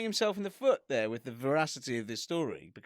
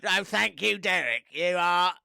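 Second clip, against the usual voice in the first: slow, robotic Dalek-like alien; stiff, mechanical alien praise; slower than usual. Acted. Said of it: "sound like a Dalek the more you do the voice".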